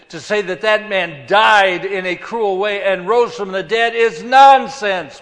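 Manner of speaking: indignant dismissal. Acted. Preacher voices Paul rejecting the resurrection, indignant dismissal. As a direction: build it up, then punch the final word.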